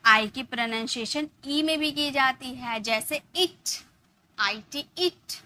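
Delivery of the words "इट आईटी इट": इट said slowly with a short i; short i sound. Shown as a demonstration. Teacher spells it out, then says इट with a short i. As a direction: stretch out इट for the class. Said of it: "ई में इसका sound आया ना".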